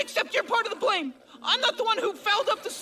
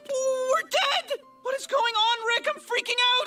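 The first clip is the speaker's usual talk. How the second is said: high, panicky outburst with a sob; panicked; 6 semitones higher than usual. Acted. Boy panicking at seeing his own dead body. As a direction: freak out at the sight of the bodies. Morty sees their own corpses.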